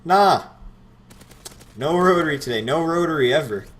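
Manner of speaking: mock the dumb question loudly; sarcastic.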